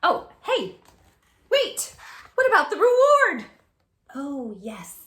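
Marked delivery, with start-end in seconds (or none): 0.0-3.5 much higher, louder, urgent; startled wait, what?; surprised interruption. Acted. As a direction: jump in like you just remembered.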